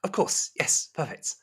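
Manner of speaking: high, courteous hostess voice; high-pitched, attentive servant; polite serving woman. Acted. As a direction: invite the guest in, pitched well up. Plays Rosemary in the game.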